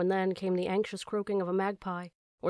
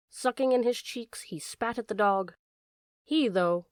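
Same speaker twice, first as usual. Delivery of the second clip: ordinary delivery, nothing marked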